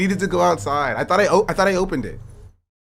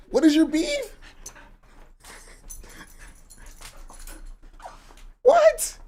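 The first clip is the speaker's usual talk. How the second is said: tiny yappy squeak, very high; high squeaky whats for the dog; much slower than usual. Pretend. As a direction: voice the dog's indignant yap. Mimicking dog's barks.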